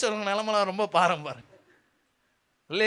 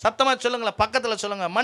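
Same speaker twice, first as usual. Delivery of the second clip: ordinary talk, nothing marked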